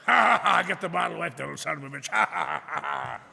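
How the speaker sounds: loud jeering laugh around the words; mocking laughter. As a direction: cackle at the kid, loud and mean. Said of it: "they'd laugh at you".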